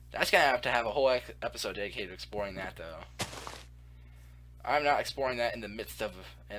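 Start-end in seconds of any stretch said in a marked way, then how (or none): none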